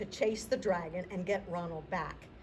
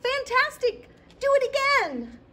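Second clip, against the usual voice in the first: high, loud, fast cheering; shout; 11 dB louder than usual. Acted. Princess urging tired dragon on, cheering shout. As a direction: yell like an eager fan. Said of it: "Elizabeth shouted".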